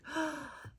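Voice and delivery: sharp delighted intake of breath; excited gasp. Real, not acted. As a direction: gasp at the fabric you love. Excited about nativity fabric.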